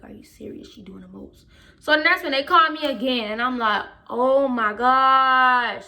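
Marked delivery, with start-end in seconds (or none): 0.0-1.5 low, very soft disbelief; exasperated aside. Retold or imitated. Upset student to herself about being called out, exasperated aside. 4.1-5.9 long, drawn-out exasperation, louder; exasperated. Retold or imitated. Student called to the office again, exasperated.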